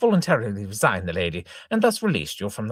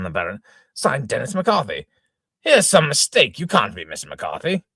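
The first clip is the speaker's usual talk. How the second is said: normal talking voice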